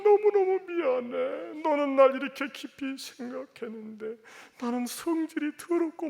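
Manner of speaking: weep out the apology to your son; father crying alone in the bathroom, wailing apology; sobbing apology. Acted. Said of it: "엉엉 울었습니다".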